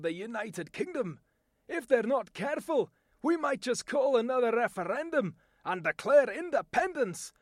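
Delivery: defiant Scottish accent; a Scot threatening independence. Shown as a demonstration. A Scot warning the English, in a Scottish accent. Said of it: "my voice talent demonstration".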